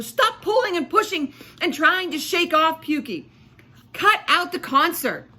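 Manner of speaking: loud, high scolding; yelled. Acted. Lulu yelling at Fleischman to stop the concert. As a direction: shout at him to quit playing. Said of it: "yelled Lulu".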